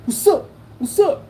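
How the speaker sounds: high mock challenge in short bursts; imitated fight challenge. Shown as a demonstration. Showing how the rival squared up, high imitated challenge. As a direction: demonstrate a would-be fighter's taunt. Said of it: "that's what they do".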